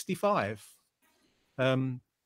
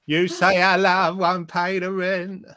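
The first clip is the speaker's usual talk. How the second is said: slow, loud mock old English accent; old-time English accent; 3 semitones higher, 7 dB louder than usual. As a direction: put on an exaggerated olden-days English accent, drawn out. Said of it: "I don't know why it's in that accent".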